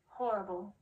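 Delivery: ordinary delivery, nothing marked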